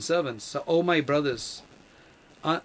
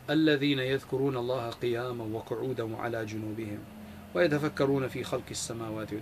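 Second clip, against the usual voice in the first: soft, rapid Arabic verse recitation; Quran recitation; 3 semitones lower, 4 dB quieter, much faster than usual. Acted. Preacher to congregation, quoting the verse in soft rapid recitation. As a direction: recite the verse lightly and quickly under the breath.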